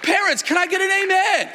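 high, loud preacher's call; shouted call for amen. Real, not acted. Preacher calling the congregation to shout back amen. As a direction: rouse the congregation to answer.

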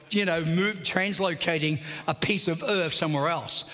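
ordinary delivery

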